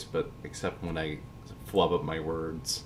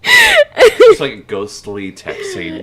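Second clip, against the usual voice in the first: laughing hard; 19 dB louder, much slower than usual. Real, not acted. Laughing hard at yawn text.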